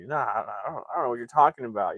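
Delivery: quick, stammered mock denial; covering up. Debbie trying to hide it from her family, evasive denial. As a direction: act out an evasive brush-off. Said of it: "trying to hide it".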